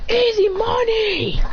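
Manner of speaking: high, drawn-out gloat; triumphant crow. Acted. Fighter gloating after a knockout, high triumphant crow. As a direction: stretch it out high in victory.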